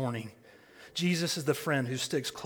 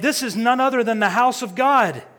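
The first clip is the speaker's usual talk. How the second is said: higher, louder awed cry; exclaimed; 9 semitones higher, 12 dB louder than usual. Acted. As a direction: proclaim it in wonder. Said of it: "he exclaimed".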